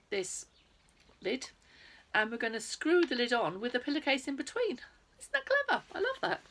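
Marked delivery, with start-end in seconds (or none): 5.2-6.4 much higher, delighted exclaim. Real, not acted. Delighted at the clever pillowcase trick.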